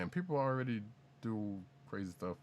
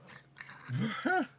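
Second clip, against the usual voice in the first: laugh; 5 dB louder than usual. Real, not acted. Laughing at the bit.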